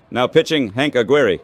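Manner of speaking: ordinary delivery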